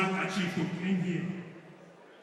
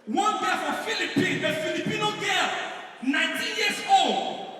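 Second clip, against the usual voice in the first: shouting; 8 semitones higher, 3 dB louder than usual. Acted. Shouted story opener.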